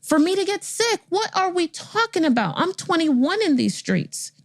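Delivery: high-pitched, indignant plea to God; exasperated appeal, pitched well up; incredulous protest. Retold or imitated. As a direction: protest to heaven in a high, outraged voice.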